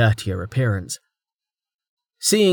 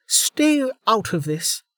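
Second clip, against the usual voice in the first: snapping; 12 semitones higher, slower than usual. Acted. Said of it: "Velika snapped".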